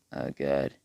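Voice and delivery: low, muted groan of dread; understated "uh-oh" reaction; dismay. Acted. Madara dismayed in story.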